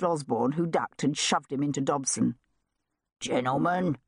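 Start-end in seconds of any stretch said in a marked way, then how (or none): 3.2-4.0 lightly voiced Cockney sergeant; menacing Cockney. A narrator's reading. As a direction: pull them up with a Cockney warning. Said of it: "The menacing Cockney tones of Sergeant Cutter".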